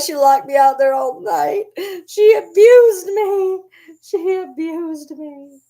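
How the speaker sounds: high, loud, slow wailing complaint; melodramatic victim. Pretend. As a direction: overplay the wronged little dog. Dog voiced complaining dramatically.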